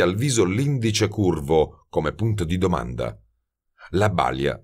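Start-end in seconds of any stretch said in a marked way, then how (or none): none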